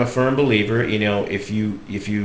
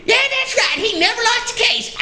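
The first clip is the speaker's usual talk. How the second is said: very high granny squeal, then a cackle; Southern old lady. Retold or imitated. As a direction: agree excitedly in a high Southern voice. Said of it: "sweet little old lady".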